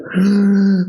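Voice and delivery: a sharp gasp of alarm; startled gasp. Acted. Father gasps at his daughter climbing a tall tree, startled.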